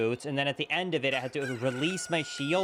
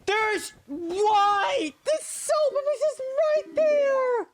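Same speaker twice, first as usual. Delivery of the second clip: very high exasperated squeal; disbelieving high cry; exasperated shriek; 5 dB louder, much slower than usual. Real, not acted. Sudden discovery annoyed.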